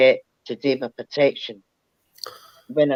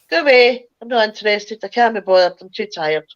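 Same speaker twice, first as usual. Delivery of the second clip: irritated dismissal; 7 semitones higher than usual. Retold or imitated. Tired woman shooing off the knocking at her window, fed up. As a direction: wave them off, too tired to care.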